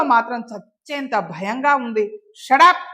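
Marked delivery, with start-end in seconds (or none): 2.4-2.8 loud, higher, cutting snap; angry shout. A narrator's reading. Woman holding a revolver shouts the man down. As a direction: slam him into silence.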